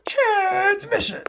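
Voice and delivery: high, slow, drawn-out incantation; a drawn-out high chant of the word; dramatic call. Shown as a demonstration. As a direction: call it out like a spell, stretched long. Dramatic demo of her technique.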